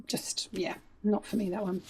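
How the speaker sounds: breathy aside whisper. Real, not acted. Dismissing a book to viewers, in a whisper. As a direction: drop to a whisper for the verdict.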